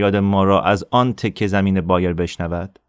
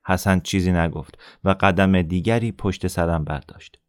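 ordinary delivery

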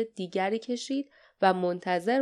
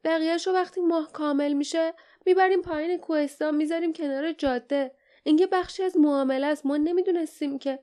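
scared, high, hurried excuse; nervous fast high voice; Gelotun; 7 semitones higher, faster than usual. A narrator's reading. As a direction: plead innocence fast and high. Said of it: "زیر لب گفت".

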